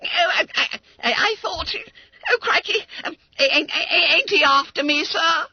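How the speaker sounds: very high, loud crying stammer; sobbing question. Acted. Boy crying to his master, high sobbing stammer. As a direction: weep with confused relief.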